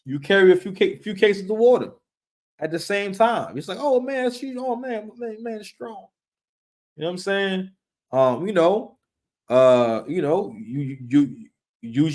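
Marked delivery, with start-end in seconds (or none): 3.8-6.1 pitched up, fading to a hush; a breathless, swooning girlfriend, trailing off; impressed girlfriend. Retold or imitated. The girlfriend marvels at his strength, swooning and fading out.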